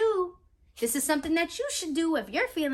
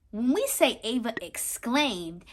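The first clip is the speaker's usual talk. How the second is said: ordinary delivery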